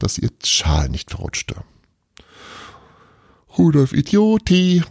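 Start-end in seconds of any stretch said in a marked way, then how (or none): 3.6-4.8 mocking jeer. A narrator's reading. Tapani mocks Rudolf.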